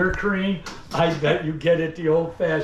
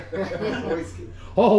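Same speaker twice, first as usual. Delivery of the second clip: laughing; much slower than usual. Real, not acted. Salesman repeating the crowd's pick, laughing. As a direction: laugh through the word whiskey.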